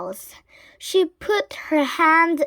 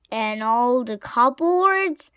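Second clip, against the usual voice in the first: usual speaking voice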